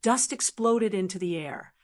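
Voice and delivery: usual speaking voice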